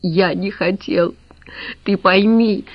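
crying. A narrator's reading. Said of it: "Лавровский плакал".

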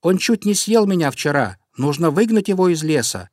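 high, agitated small-bird voice; storybook robin, pitched well up; little robin complaining. A narrator's reading. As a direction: plead indignantly as a tiny robin. Robin keeps crying out her complaint.

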